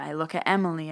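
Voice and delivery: usual speaking voice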